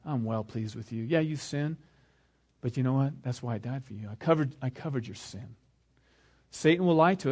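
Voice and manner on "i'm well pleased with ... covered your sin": low, soft, tender assurance; God's reassurance. Acted. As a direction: speak gently down to a forgiven child. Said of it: "God says".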